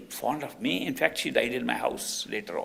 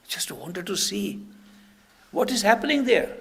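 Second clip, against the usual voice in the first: acted protesting excuse; 8 semitones higher, 5 dB louder than usual. Disciple excuses her curiosity.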